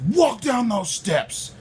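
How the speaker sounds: high, slow imitated cop's yell; high-pitched drawn-out command; barked order. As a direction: holler it with every word stretched. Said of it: "He's screaming at me".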